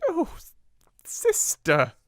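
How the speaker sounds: high, tearful sniffed "Oh, s-, sister"; sniffing. Acted. Amelia wrings her hands before her sister, sniffling. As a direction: whimper through sniffles. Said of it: "she sniffed".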